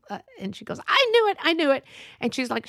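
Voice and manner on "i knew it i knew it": high, loud gloating cry; triumphant I knew it. Retold or imitated. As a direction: crow it out like a gossip proven right. Busybody triumphant at the guess.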